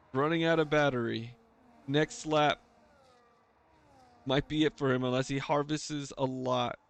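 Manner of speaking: ordinary delivery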